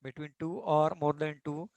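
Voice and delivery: slow, even dictation pace for notes. Acted. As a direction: spell it out slowly so students can write. Teacher dictating a definition slowly. (धीमी गति से लिखवाना)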